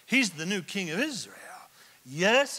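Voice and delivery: ordinary talk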